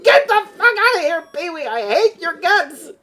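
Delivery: hammed-up fury at the man outside; mock yelling. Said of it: "throwing shit at him from the windows".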